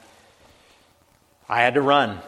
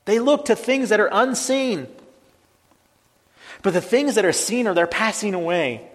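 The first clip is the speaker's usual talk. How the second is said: raised, higher preaching voice building up; fervent preaching; 8 semitones higher, 5 dB louder than usual. Acted. Preacher stirring the congregation, higher and louder. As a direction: preach it out with rising fire.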